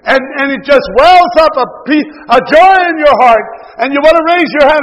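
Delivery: loud, rising sermon voice; preaching at full force. Acted. Preacher stirring up his congregation, in a loud preaching voice. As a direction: preach it out to the whole church.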